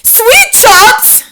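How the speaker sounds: high, loud witch chorus echoing; loud high witches crying. A narrator's reading. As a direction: echo the leader in a high cry. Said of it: "they cried".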